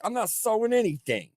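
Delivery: mock girl voice. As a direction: protest as a huffy sister.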